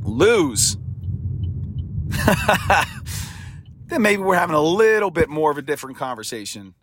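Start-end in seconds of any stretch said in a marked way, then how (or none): 0.8-3.5 long, breathy chuckle; laugh. Real, not acted. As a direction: chuckle at the thought of losing. Laughs at the thought of losing.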